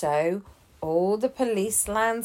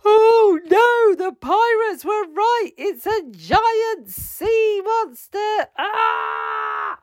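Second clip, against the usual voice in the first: much higher, crying out in mock panic; panicking crew; 4 dB louder, slower than usual. A narrator's reading. Crew sees the giant tentacle and panics, hammed-up screaming. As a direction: squeal in hammed-up fright. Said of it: "all the crew started to panic".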